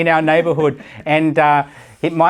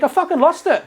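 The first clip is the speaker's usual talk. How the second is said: rueful exclamation; 13 semitones higher than usual. Real, not acted. Telling crypto loss.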